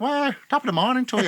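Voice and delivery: high, loud stage Irish; mocking someone's pretend Irish accent; fake Irish accent. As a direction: greet in a hammed-up Irish lilt. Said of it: "She weren't even Irish".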